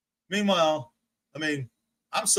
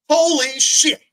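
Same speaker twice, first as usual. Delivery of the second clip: shocked exclamation; 9 semitones higher, 8 dB louder, slower than usual. Real, not acted. Shocked by paint colour.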